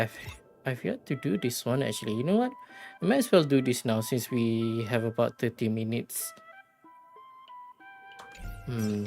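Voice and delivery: ordinary delivery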